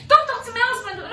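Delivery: high, loud mocking of the wife's shriek; imitated shriek. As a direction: shriek it back like the angry wife. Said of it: "And she was like".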